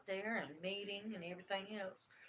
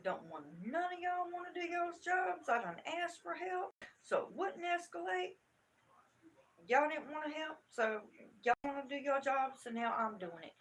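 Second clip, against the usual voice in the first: raised, high-pitched scolding; retold angry complaint; 5 semitones higher, 4 dB louder than usual. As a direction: lay into the school staff, pitched up. Retold angry confrontation with staff.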